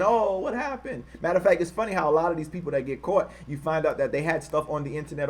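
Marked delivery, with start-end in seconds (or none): 0.0-1.0 higher, slow mock-innocent surprise. Streamer mocking people who act surprised after an attack, feigned surprise. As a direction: play the bigots acting shocked.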